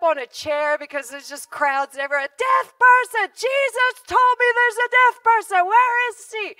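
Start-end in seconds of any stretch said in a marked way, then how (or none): 2.4-6.6 high, loud shouting. Retold or imitated. Preacher on a chair, shouting over the crowds.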